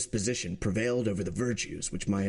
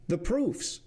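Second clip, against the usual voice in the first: higher, demanding call with a falling end; calls for the proofs firmly, higher; demanding president; 9 semitones higher than usual. Acted. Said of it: "said the president".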